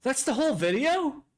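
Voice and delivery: high, disbelieving exclamation; as if the rug got pulled out; incredulous surprise. Real, not acted. Streamer finding the video already over, high incredulous surprise.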